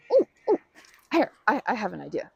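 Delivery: sudden high, loud ooh of inspiration; excited idea. Real, not acted. Suddenly struck by an idea for her friend, excited. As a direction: blurt out the idea, thrilled.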